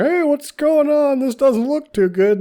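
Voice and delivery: high, cheery sheriff voice; sheriff arriving. Retold or imitated. Sheriff Glenn walks in on the scene, cheery high voice. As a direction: call out brightly like a small-town sheriff. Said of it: "he's like".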